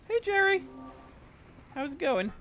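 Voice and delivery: high falsetto pet talk; cutesy greeting. Pretend. Streamer checking on Jerry, cutesy high greeting. Cooing to his pet creature.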